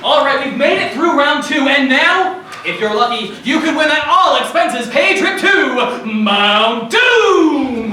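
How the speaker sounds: loud game show host; booming prize announcer. Acted. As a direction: sell the grand prize big.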